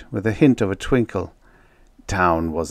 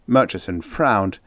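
plain reading voice

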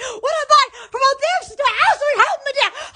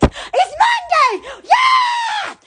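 much higher, drawn-out yeah; excited cheer; 9 semitones higher, much slower than usual. Real, not acted. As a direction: stretch it out like a celebration. Cheering the day.

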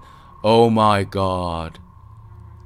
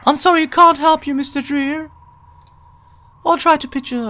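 put-on much higher, alarmed little girl; cute high voice; 4 dB louder, faster than usual. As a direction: gasp it like a startled child. Voices game character.

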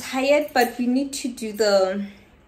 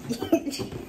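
laughing; 4 semitones higher than usual. Real, not acted. Happy breathy laugh.

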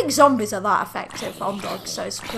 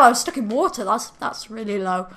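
ordinary talk, nothing marked

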